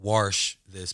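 Warsh drawn out with an R in it; slow, deliberate R in Warsh; wash with added R. Shown as a demonstration. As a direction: say Warsh slowly, rhyming with "marsh" or "Porsche". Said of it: "renaming the podcast from Wash This to".

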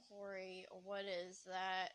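low, slow, weary groan; so done. Acted. Girl shown Corey's picture, so done. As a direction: drag it out, completely fed up. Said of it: "so done with it, so done with everything".

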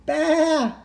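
high baa; animal bleat. Acted. Pig that has caught the lamb's sound, high bleat. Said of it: "said the pig".

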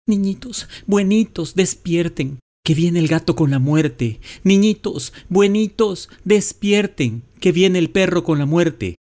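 aguda, urgente y suplicante; súplica asustada. A narrator's reading. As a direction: despierta a los niños con pánico. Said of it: "les decía". (ruego agudo y desesperado)

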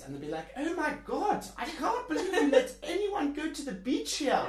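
high, loud mocking South African accent with laughs. Perth local mocking South African expats, loud high South African accent. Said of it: "can I do my accent?".

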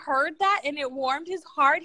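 usual speaking voice

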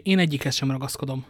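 ordinary delivery, nothing marked

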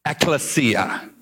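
"ecclesia" said slowly as EK-leh-see-ah; Greek word said slowly. Shown as a demonstration. Pastor teaching the congregation the Greek word, slow and careful. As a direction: spell out "ecclesia" syllable by syllable. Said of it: "And that word is this".

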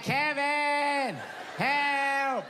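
high, far-off cry for help; the wife struggling in the river, calling to Kevin. Retold or imitated. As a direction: call out from the water. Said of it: "She's like".

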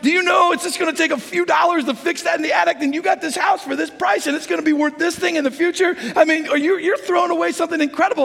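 mock the realtor's disbelief; upset realtor. Realtor scolding the pastor over the deal, exasperated. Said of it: "my realtor was ticked".

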